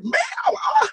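high-pitched exclaimed "Man"; high, astonished yelp in retelling; mock outcry. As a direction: cry out in mock disbelief. Acts out shocked reaction to trampling.

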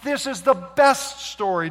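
high, loud and slow, riding the thrill; excited. Acted. Preacher describing the cheering crowd, excited and loud. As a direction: pile up the celebration.

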